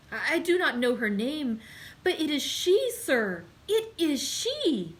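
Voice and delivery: high, loud, stammering horror; horrified. A narrator's reading. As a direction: servant certain he has seen her again. Servant insisting in horror.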